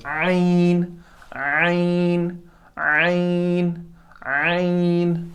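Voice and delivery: आइन drawn slowly from the middle of the throat; हलक से आइन; throat letter demo. Shown as a demonstration. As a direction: squeeze आइन out of the mid throat, long and slow. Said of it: "गले के बीच वाले हिस्से".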